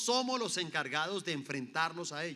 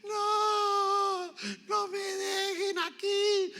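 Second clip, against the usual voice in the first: put-on very high, slow wail of a frightened child; children left on the first day, wailing; 5 dB louder, much slower than usual. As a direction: cry out like a kid left at school. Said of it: "estaban lo mismo".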